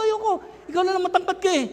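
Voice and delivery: high-pitched protest; hammed-up high objection to a cousin; acted refusal. His younger self refusing the cousin.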